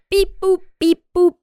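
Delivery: high, bouncy robot beeps; toy robot voice. Acted. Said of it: "I'm just staying in character".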